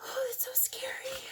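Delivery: hushed, high pretend-scared whisper; mock-scared whisper. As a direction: whisper it like you're spooking a child. Scary storm page aside.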